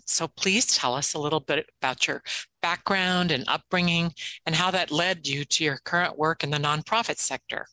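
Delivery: usual speaking voice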